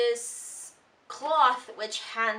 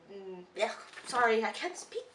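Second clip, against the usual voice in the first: ordinary talk, nothing marked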